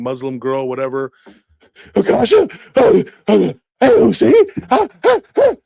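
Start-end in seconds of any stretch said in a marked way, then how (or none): 1.9-5.5 high, loud ape hoots; chimpanzee noises. Pretend. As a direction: hoot like an excited chimp. Rabid chimpanzee girl.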